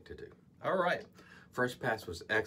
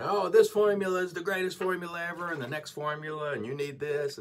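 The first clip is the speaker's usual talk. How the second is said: much higher and louder mock ad voice, fading toward the end; overexcited advertiser mocked, then winding down; hyped-up sales pitch; 9 semitones higher, 5 dB louder than usual. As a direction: parody a breathless marketing spiel. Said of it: "prey to the marketing".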